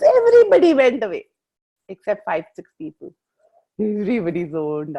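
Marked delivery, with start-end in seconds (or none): 0.0-3.1 higher, louder amused exclamation; playful feigned shock, higher and louder; mock surprise. Real, not acted. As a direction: act startled that everyone left. Joking that listeners zoned out.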